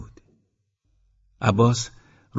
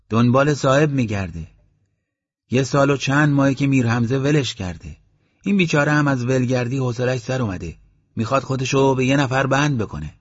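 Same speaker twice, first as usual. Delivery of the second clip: a hint of an amused villager explaining, higher; chatty villager, lightly played; lightly voiced villager; 5 semitones higher, 4 dB louder than usual.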